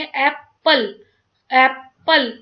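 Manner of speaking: "एप्पल" broken in two, ऐप then पल; split into syllables. Shown as a demonstration. Teacher showing two syllables, "एप्पल" split as ऐप, पल. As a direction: say "एप्पल" in two separate beats. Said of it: "दो word में हम इसको बोल रहे हैं".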